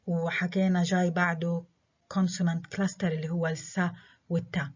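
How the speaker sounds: normal talking voice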